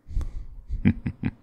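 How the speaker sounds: low-key, soft knowing chuckle; amused villain chuckle. Acted. Demon showing the hero a crystal ball, soft knowing chuckle. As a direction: let out a quiet, pleased chuckle.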